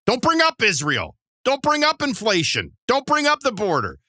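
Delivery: high, mocking list of don'ts. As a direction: sneer out each forbidden topic. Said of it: "follow the wording in this".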